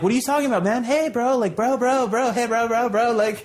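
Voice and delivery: high-pitched rush of pleading bros; acted protesting innocence. Thief stopping the angry owner on the trail, high pleading protest. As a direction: plead up high, talking over him.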